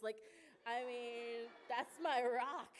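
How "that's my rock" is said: loud, slow, laughing fondly. Real, not acted. Praising Regina to the church, laughing fondly. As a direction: laugh it out with love.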